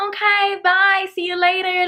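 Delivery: high, loud, sing-out goodbye; fake-friendly farewell. Storyteller escaping the scary neighbour, high fake-friendly goodbye. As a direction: call it out high with put-on friendliness.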